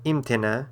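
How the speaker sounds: imtena with the opening EEM stressed; stress on first syllable. Shown as a demonstration. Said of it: "that's where the stress falls".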